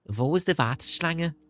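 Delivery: put-on German line in a light Scouse accent; a hint of Liverpool in the German. Streamer showing viewers a light Scouse accent in German. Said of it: "Because I can do Scouse".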